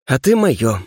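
ordinary delivery

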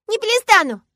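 put-on very high, loud little-girl refusal; defiant child shout. A narrator's reading. Girl refusing to stop screaming, defiant. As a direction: yell back stubbornly.

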